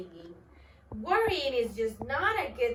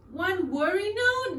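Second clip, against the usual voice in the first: higher, startled question; surprised; 7 semitones higher, 4 dB louder than usual. Acted. Bird surprised by the worm's words, startled. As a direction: echo it in surprise. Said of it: "was surprised".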